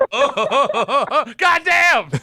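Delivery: laughing it out. Real, not acted. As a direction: burst out laughing. Host laughs at caller's pick.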